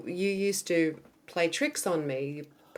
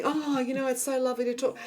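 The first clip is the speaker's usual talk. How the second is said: mock gushing callers; 5 semitones higher than usual. Imitating gushing callers on the phone.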